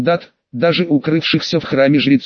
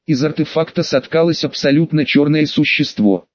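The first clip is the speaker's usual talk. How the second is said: ordinary delivery, nothing marked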